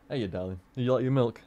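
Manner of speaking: soft cat talk. Real, not acted. Talking softly to his cat.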